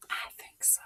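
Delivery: soft whispered answer. Real, not acted. Storyteller answering the listening child, whispered. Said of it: "answering child quietly".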